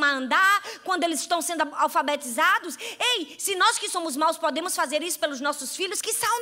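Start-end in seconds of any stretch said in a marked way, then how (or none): none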